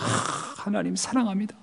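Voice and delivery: mock tearful devotion. Mocking churchgoers who weep while saying they love God, hushed and teary. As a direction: imitate an overly emotional confession, hushed. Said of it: "가끔 그런 분들을 이렇게 보면은".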